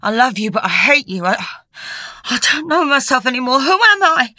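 distraught. A narrator's reading. Matt breaking down.

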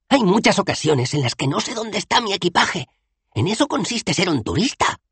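high-pitched self-justifying explanation; defensive explaining. A narrator's reading. Dos Flores explains tourism to Bethan, defensive and high. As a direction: explain it up high, excusing yourself. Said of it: "explicó".

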